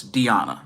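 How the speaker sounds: "Diana" said dee-AH-na, with ee and ah. Shown as a demonstration. Reviewer correcting own pronunciation of the promotion, "Diana" as dee-AH-na. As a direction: say "Diana" with a long ee, then a stressed ah. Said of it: "did catch the right pronunciation".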